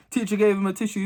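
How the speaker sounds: ordinary delivery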